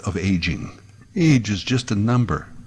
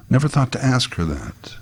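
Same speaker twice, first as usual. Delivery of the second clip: ordinary talk, nothing marked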